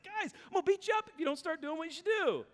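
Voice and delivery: high, rapid, comic warning; hammed-up wound-up exasperation; uptight mock threat. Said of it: "He's just so tight".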